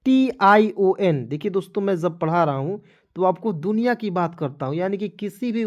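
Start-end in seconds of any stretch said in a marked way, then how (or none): 0.1-1.3 टी आई ओ एन spelled slowly and louder; slow spelling. Shown as a demonstration. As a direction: stretch out each letter of टी आई ओ एन, loud and clear. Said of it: "के अंतिम में".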